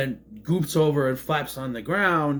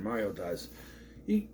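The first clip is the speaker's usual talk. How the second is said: ordinary delivery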